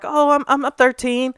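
a hint of a kid stammering out his age; kid's voice. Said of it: "He sounded like he was about ten years old".

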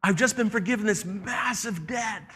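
high, shouted celebration; party excitement. Acted. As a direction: cheer like you just got off free. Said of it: "instead of saying".

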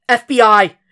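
loud, high announcement; shout. A narrator's reading. Will identifying himself to the panicked woman, loud shout. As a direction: bark the letters out hard. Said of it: "he shouted".

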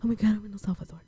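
muted replay of the excitement; toned-down exclamation. Retold or imitated. Repeats the arrival exclamation, toned down.

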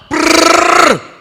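a loud rattling brrr like a gun firing; gunfire noise. Retold or imitated. The gunman fires to prove the gun is loaded, loud brrr. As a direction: rattle it out like a burst of bullets.

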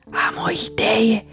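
whispered, a little proud of the idea. Acted. Bee whispering her plan to the wasp, proudly. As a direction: breathe it like a clever secret. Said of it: "șoptește Bâz cu mândrie".

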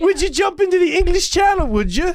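high, loud teasing voice; mock British voice. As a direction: tease her in a put-on accent.

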